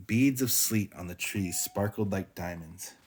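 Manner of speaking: ordinary delivery, nothing marked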